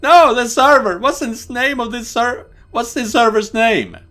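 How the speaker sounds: high, loud, urgent questioning; frantic insistence. Acted. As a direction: demand it desperately. Desperate for the server's name.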